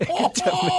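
high squeal through laughter; laughing. Real, not acted. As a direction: plead through a fit of giggles. Host laughing, trying to regain control.

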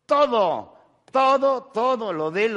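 high, loud, mocking todo todo todo; hammed-up scorn; mock outburst. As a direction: throw out the words like a wasteful spender. Mocking the spendthrift who wastes all.

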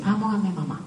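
put-on quiet high little child repeating; lightly voiced toddler repeating; child's voice.

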